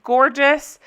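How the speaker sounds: normal talking voice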